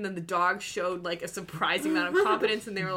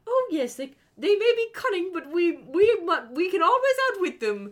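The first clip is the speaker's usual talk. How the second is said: high, loud rallying voice; rally the troops, hammy; heroic movie character; 10 semitones higher, 6 dB louder than usual. Retold or imitated. Retelling a character's heroic plan.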